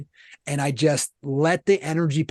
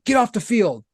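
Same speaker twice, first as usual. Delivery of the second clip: higher-pitched, half-strength sideline shout; mock coach yell, pitch raised; retold yell; 6 semitones higher, 3 dB louder than usual. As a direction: re-enact ordering a player off. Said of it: "I just yelled".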